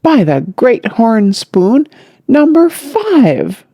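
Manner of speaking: slow, rising to a high wail; dismayed old man's exclamation; aghast. Acted.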